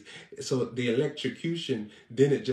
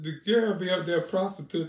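ordinary talk, nothing marked

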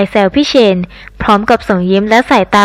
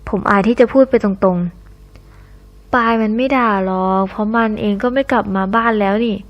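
ordinary delivery

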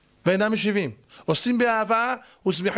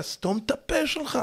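acted retort; 6 semitones higher, 4 dB quieter than usual. Wife throwing a word at her husband, acted retort. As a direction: toss it out high, holding back volume.

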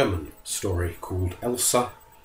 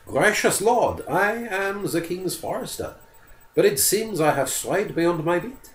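higher, courteous and careful; bow your voice to a dangerous lord; polite forester; 8 semitones higher than usual. Acted. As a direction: forester politely answering the bear. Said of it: "answered as politely as he knew how".

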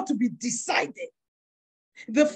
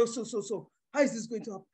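quietly fretting aloud; worried mutter; 5 semitones lower, 9 dB quieter, faster than usual. Retold or imitated.

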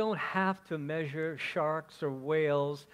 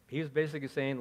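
normal talking voice

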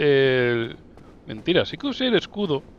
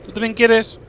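burla cariñosa; 9 semitones higher, 6 dB louder, slower than usual. Pretend. Habla al enemigo en tono burlón.

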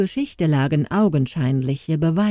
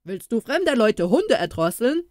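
gruff voice; 6 semitones higher than usual. A narrator's reading. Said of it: "fragte plötzlich eine barsche Stimme".

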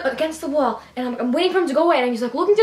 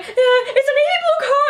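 nervous high voice; 9 semitones higher than usual. Retold or imitated. Nervous call to police.

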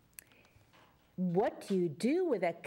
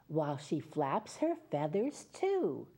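put-on reading to children; 2 semitones lower than usual. Reads picture book to children.

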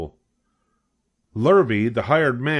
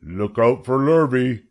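slow, drawn-out cow call; 2 semitones higher, 4 dB louder than usual. A narrator's reading. The cows warn Wilbur of Lurvy, calling slowly. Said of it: "called the cows".

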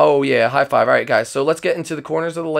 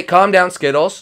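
ordinary talk, nothing marked

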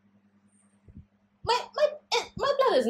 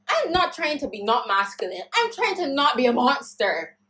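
ordinary talk

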